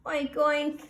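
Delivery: high playful oinks; piglet oinks. Acted. Full piglet oinks at the family, high and playful. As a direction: oink like a happy piglet. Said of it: "she oinked".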